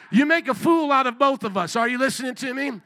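usual speaking voice

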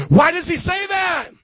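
high, insistent question; emphatic question. Real, not acted. Preacher asks congregation about Paul's words, emphatic high question. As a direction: throw the question out to the room.

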